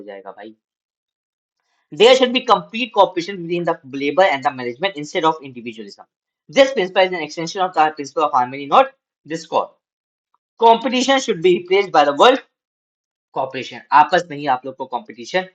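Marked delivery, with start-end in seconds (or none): 1.9-13.8 slow, measured reading aloud to students; slow classroom read-aloud; slow textbook reading. Acted. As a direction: read each phrase out deliberately for the class.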